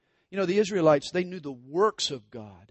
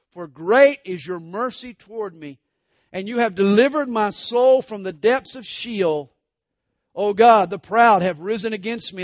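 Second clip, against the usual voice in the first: louder, ringing scripture reading; psalm proclaimed; 3 semitones higher, 9 dB louder than usual. Acted. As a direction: declaim the psalm from the pulpit.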